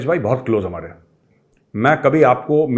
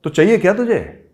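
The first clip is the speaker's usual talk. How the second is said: sharp, raised, insistent question; pressing chairman; 8 semitones higher, 6 dB louder than usual. Retold or imitated. Chairman demanding to know what he wants, loudly insistent. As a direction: push him hard for an answer.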